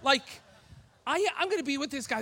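sudden high gasped oh my God; shocked high exclamation; panicked realization. Retold or imitated. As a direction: jolt up into a shocked squeak. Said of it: "and then I'm like".

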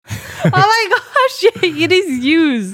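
high, slow drawn-out oh my gosh; shocked disbelief. Real, not acted. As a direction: stretch it out in disbelief. Disbelief at the answer.

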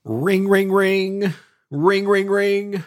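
high, loud sing-song bell ringing; Salvation Army bell. Pretend. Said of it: "This is the Salvation Army's bell ringers".

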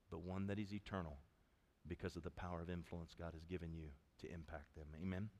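tender preaching. Acted. Pastor honoring mothers, soft tender preaching.

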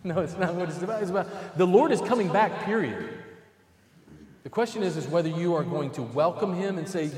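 ordinary talk

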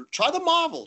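put-on "Try the Marvel" pitched high in a British accent; British mate pushing the Marvel, up high. Bowler imitates Stu recommending the Marvel ball, high British accent. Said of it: "in his British accent".

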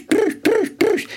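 a high, ringing cry across the mountainside; shepherd's herding call. Shown as a demonstration. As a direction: call the goats and sheep in for salt. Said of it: "Και φωνάζουμε".

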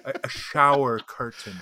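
imitated Irish brogue; Irish accent. Irish teacher repeating himself to baffled shop staff, imitated accent. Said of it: "nobody could understand a word he was saying".

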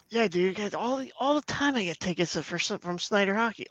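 high, boastful buddy voice; mock bragging friend. Co-host mimicking a friend bragging about tickets, high and boastful. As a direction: brag about the free tickets.

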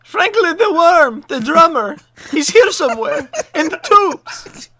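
high, loud, slow excited yelling; excited shout. Acted. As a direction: shout his name high and slowly. Character shouts about worm.

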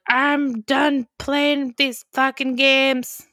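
slow, mock-menacing growl of a line; angry king. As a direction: drag it out like a fed-up villain. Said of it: "he's yelling, and he's pissed".

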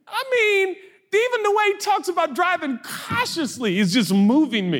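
hammed-up high, choked-up awe; mock tearful. Preacher gushes over bishop's driving talk, mock-tearful high voice. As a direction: pitch way up, pretending to be moved. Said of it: "I'm, like, in tears about cautious driving".

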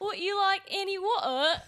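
high, giggly Cockney question; amateur Cockney with dropped syllables; attempted Cockney accent. Shown as a demonstration. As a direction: put on bad Cockney, swallowing the t in "water". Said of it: "take little syllables out".

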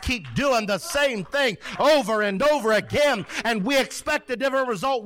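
high-pitched, emphatic pulpit delivery; impassioned preaching. Acted. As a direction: preach it high, driving home each phrase. Preaching at full force.

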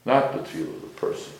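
normal talking voice

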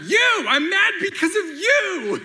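high, loud yelling outburst; mock angry shout. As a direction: shriek it out like an upset wife, slowing on the second line. Kenzie quarrel retold, acted.

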